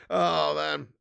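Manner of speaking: usual speaking voice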